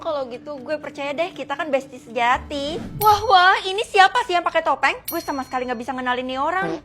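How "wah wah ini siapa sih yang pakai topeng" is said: loud, raised mock wonder; taunting. Classmate taunting masked outcast, loud mocking surprise. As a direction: crow it at the victim.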